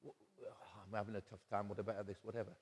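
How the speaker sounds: soft, private confession of troubles; hushed confiding. Retold or imitated. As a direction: confide it quietly, as if no one else should hear.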